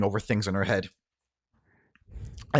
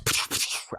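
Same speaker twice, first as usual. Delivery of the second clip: mouthed gunfire; imitated gunshots. Storyteller adds gunfire outside, mouthed gunshot sounds. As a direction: puff out a burst of gunshots. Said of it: "we hear more gunshots".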